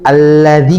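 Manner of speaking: "alladzi" with an ordinary light double L; lam ganda biasa. Shown as a demonstration. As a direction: keep the held L thin in "alladzi". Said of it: "yang double biasa". ("alladzi" dengan lam tipis biasa)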